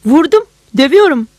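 high, loud gleeful cry; triumphant, thrilled he finally hit; joyful exclamation. A narrator's reading. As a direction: policeman overjoyed he can finally beat the suspect. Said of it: "Sevinçle".

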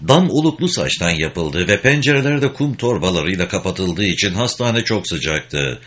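ordinary delivery

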